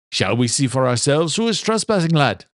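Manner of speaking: lightly voiced Irish father, daring his son; Irish brogue with a mischievous dare; a hint of an Irish father teasing; Irish father, playful. Father's voice in story.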